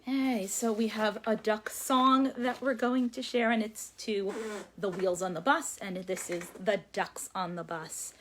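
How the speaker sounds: ordinary talk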